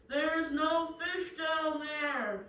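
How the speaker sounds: very slow, loud, high shout; voice from above. Acted. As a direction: thunder it from the heavens, drawing out each word. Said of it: "again the voice says".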